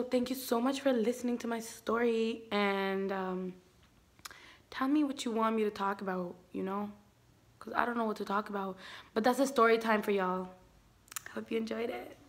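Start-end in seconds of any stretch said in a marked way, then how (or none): none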